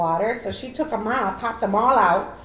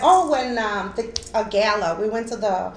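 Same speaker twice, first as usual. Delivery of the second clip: ordinary talk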